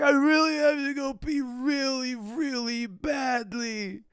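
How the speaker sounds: high, drawn-out whimpering plea; mock crying kid. Girl in the queue crying that she needs to pee, high and drawn-out. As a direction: whine through fake tears. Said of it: "bursting into tears".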